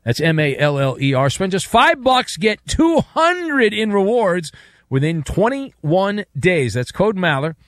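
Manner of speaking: upbeat radio ad read. Acted. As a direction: sell it like a sponsor spot. Host reads live sponsor ad.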